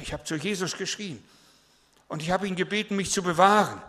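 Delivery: high, loud and urgent retelling of the night; excited teenager. Retold or imitated. Teen telling the pastor about the lightning, high excited voice. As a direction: blurt out the lightning story, shaken and eager. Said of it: "Sagt er".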